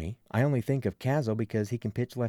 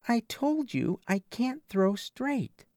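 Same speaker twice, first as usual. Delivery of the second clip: put-on slow, higher girl's voice, stubborn; Rita's voice; 12 semitones higher, slower than usual. A narrator's reading. Rita protesting to her coach, slow and high. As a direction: drag it out like a reluctant teen pitcher.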